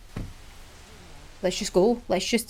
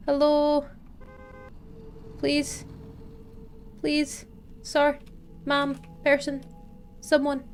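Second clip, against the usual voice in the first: high calls of hello and please to the road; calling out; 8 semitones higher, 3 dB quieter, slower than usual. Acted. Stranded driver calling to a passing car, pleading. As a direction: plead for someone to stop.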